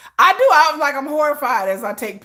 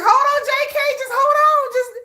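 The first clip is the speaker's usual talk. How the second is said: mock bystander call; 11 semitones higher than usual. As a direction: shout encouragement while not helping. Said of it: "He was like".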